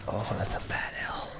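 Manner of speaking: breathy whispered sympathy. Real, not acted. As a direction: whisper it sadly. Reacting to bad story.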